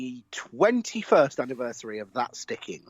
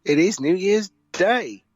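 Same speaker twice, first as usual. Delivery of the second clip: shouting; 5 semitones higher, 5 dB louder than usual. Acted. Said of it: "apologies for the shouting".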